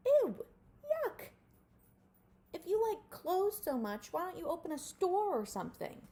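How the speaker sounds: high, loud squeal of disgust; disgusted mole rats. A narrator's reading. Mole rats recoil at Wilbur's clothes, loud disgusted squeal. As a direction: shriek it like grossed-out kids.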